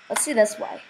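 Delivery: normal talking voice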